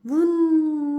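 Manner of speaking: loud blown wind noise; wind whoosh. Acted. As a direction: blow it like a gust. Wind blows again.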